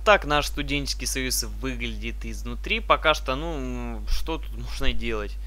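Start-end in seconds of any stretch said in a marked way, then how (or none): none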